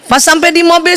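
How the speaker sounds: ordinary talk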